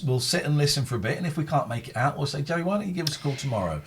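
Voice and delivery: ordinary talk, nothing marked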